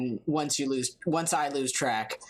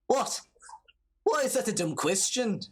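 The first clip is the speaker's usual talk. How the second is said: attempted Irish accent; 6 semitones higher than usual. Same Irish game character answers back.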